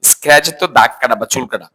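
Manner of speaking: normal talking voice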